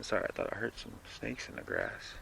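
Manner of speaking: a soft whispered apology, breaking off the reading; whispered aside. Real, not acted. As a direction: drop to a whisper for a quick aside. Aside while reading a story.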